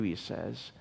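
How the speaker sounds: ordinary delivery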